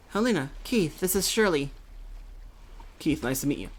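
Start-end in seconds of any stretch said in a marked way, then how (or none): none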